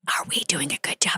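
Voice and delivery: soft whisper under the breath; whispered check. Retold or imitated. Actor talking to herself mid-scene, whispered. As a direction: whisper it like a secret question.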